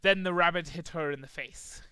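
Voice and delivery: ordinary delivery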